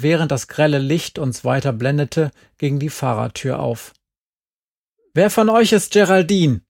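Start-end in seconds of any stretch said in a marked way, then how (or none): 5.1-6.6 loud, higher, stern demand; stern woman's voice. A narrator's reading. Stern mother demands from the jeep which girl is Geraldine. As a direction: bark the question at the kids. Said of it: "fragte eine strenge Frauenstimme".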